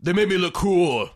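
playful, boastful Irish character voice; Irish accent. Acted. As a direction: put on a cocky Irishman's brogue. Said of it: "That's a great Irish accent".

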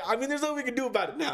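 quick, high-pitched mock reasoning; silly high voice, rushed; goofy friend impression. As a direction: imitate a goofball brushing it off fast.

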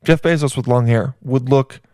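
ordinary delivery

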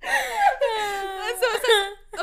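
high, slow, strangled by laughter; laughing to tears. Real, not acted. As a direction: squeeze the words out through helpless laughter. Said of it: "actually crying right now".

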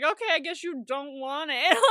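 mock giving in. Mocking how the character backs off.

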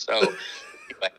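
laughing it off; amused, laughing trail-off. Real, not acted. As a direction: chuckle through the words. Laughs at his own story.

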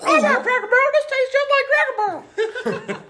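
shrieking-high, loud falsetto; hammed-up eager child piping up; mock excited kid. One kid agrees about the breakfast, mimicked loud squealing falsetto. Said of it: "one of the kids like".

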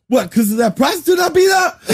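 high, loud mock yell; mock shout. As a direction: yell it like a hammy drama. Acted quarrel, mock confrontation.

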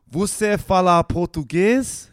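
slow, high Brazilian Portuguese; Brazilian Portuguese accent. Shown as a demonstration. Showing off the sexy accent to co-host, Brazilian Portuguese. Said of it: "That is a sexy accent".

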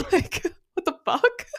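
high laughing disbelief. Real, not acted.

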